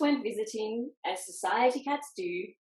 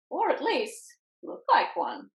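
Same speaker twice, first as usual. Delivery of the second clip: Winky voice; 5 semitones higher than usual. A narrator's reading. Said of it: "said One-Eyed Winky".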